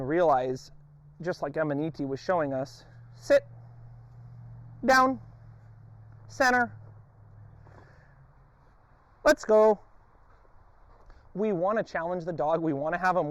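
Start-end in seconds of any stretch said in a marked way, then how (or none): 3.1-9.8 high, loud call to the dog; sharp command. Real, not acted. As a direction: snap the command out.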